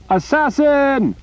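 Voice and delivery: high, drawn-out cry of alarm; distant shout. Acted. Soldiers outside raising the alarm, shouting. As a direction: yell it from far off.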